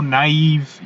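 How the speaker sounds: normal talking voice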